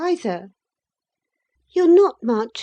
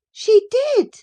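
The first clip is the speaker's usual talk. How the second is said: put-on very high, loud girlish cry; insistent cry; 11 dB louder than usual. A narrator's reading. Young lady contradicts her cousin, high indignant cry. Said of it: "cried my young lady".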